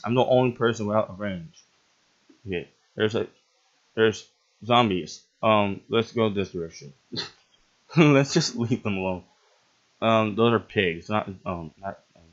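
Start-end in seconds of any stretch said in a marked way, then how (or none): none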